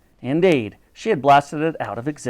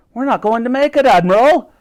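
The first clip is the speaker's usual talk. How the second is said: higher, louder warning call; 9 semitones higher, 9 dB louder than usual. A narrator's reading. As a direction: shout the warning down the ship. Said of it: "called out Titch from the bow".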